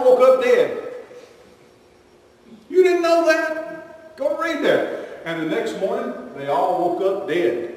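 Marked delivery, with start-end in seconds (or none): 2.7-5.2 teasing challenge. Real, not acted. Preacher teasing congregation.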